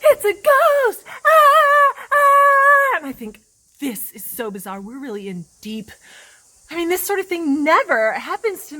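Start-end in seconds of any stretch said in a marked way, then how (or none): 0.0-3.0 high, loud scream of terror; hammed-up panicked screaming; freaking out. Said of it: "totally freak out". 3.8-5.9 low, soft private thought; quiet, low worry; hushed aside. Acted. Water droplet's thought during panic.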